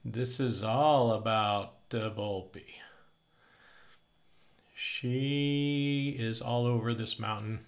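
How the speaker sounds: slow, deliberate show-host announcement; show host intro. Acted. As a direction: welcome viewers like a TV host. Show intro for fox footage.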